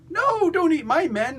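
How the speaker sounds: very high, drawn-out mock protest; hammed-up panicky wail at the beast; dismayed villain. Villain protests as dog eats men.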